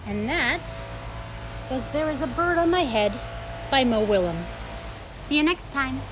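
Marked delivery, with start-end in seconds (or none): none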